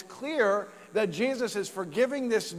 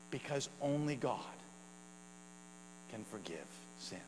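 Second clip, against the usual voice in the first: hushed solemn; 5 semitones lower, 15 dB quieter than usual. Real, not acted. Sermon climax, hushed answer.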